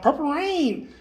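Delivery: high-pitched mock yell; imitated yell. Retelling somebody calling out in the airport, imitated yell. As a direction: call it out high, without full volume. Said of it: "somebody yells out".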